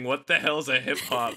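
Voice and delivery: usual speaking voice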